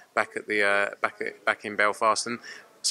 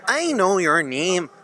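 slow, high Belfast impression; Belfast accent; 8 semitones higher, 4 dB louder, much slower than usual. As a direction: drawl it out in a Northern Irish accent. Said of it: "You have to say what Eddie said yesterday".

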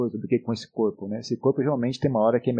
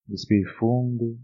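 soothing spa whisper; 3 semitones lower than usual. Acted. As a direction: ease them gently into stillness. Leads a meditation.

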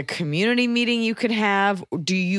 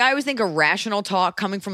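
ordinary talk, nothing marked

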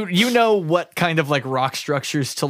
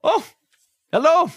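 lightly voiced flustered scientist, higher; a hint of a fumbling lab doctor, pitched up; nervous scientist; 6 semitones higher than usual.

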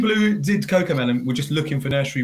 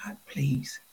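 breathy pleading whisper; whispered plea; 2 semitones lower, 10 dB quieter than usual. Real, not acted. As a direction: whisper a desperate please. Begging for vegetables.

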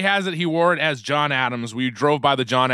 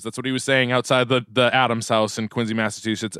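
ordinary delivery

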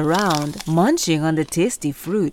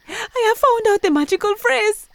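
high, proud hyena; bursting with the secret; excited hyena voice. Acted.

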